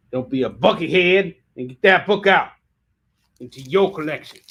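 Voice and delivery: loud put-on hawker's voice; hammed-up sales pitch.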